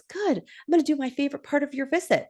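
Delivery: high, quick, eager hostess voice; imitating an eager host; LaDonna. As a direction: chirp it fast in a put-on high voice. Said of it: "And LaDonna goes".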